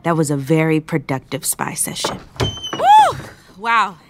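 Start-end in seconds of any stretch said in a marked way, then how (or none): 2.7-3.2 high, loud "woo"; excited whoop. Acted. Spy thrilled after eavesdropping in the pantry, excited whoop.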